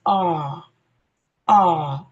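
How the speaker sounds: "R" as a long open "ah", no r at the end; drawn-out non-rhotic "R", tongue down; British R sound. Shown as a demonstration. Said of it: "if you're using the British standard".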